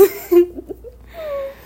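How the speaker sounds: bright high-pitched laugh. Real, not acted. Laugh at own joke.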